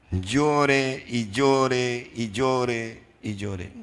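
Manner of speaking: very slow, stretched, mock-mournful repetition; drawn-out mock lament. Preacher mocking Mary's nonstop crying, slow mock lament. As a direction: drag each llore out like endless weeping.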